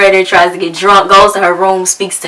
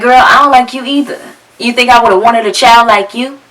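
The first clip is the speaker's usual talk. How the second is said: cold comeback; 4 semitones higher than usual. Retold or imitated. Mom snapping back at daughter.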